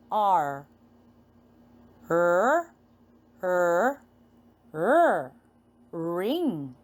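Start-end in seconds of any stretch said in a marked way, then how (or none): none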